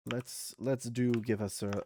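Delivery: normal talking voice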